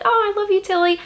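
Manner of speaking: high, squealy adoring gush; imitating her own swooning fan voice; gushing fangirl. Fan gushing at author on Instagram, high adoring squeal. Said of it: "and I'd be like".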